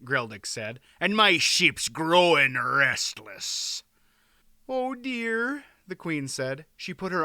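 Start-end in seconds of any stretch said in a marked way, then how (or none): 1.0-3.8 loud, slow captain's voice; loud, drawn-out seaman. A narrator's reading. Sea captain hails the king. 4.7-5.6 high-pitched little lament; high, upset wife; dismayed queen. A narrator's reading. Tearful queen at parting.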